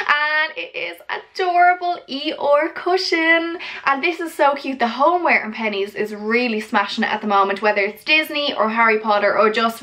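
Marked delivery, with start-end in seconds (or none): 0.1-4.8 gushing. Real, not acted. Showing off cute cushion.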